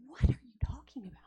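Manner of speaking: whispered, baffled question; bewildered whisper. Retold or imitated. First-time listener to Jesus, whispering in confusion. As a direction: breathe it out in disbelief.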